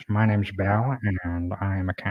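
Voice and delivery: lightly voiced pet cat; a hint of a talking cat. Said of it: "this is his voice".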